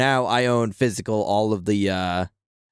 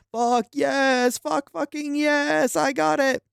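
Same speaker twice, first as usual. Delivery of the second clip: high, thrilled cry of victory; elated cheer; 13 semitones higher, slower than usual. Real, not acted. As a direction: cheer it like you just won. Celebrating the game he got.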